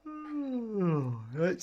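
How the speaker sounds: words stretched through a yawn; real yawn. Real, not acted. Owner yawning as the cat licks his face, mid-yawn. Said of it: "while I'm yawning".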